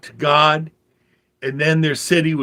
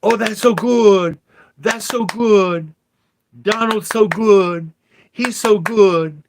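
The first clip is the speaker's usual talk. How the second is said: mocking fawning praise; 6 semitones higher, 3 dB louder than usual. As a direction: fawn over him like a sycophant, pitched up and louder. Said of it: "we're clapping like the Koreans do".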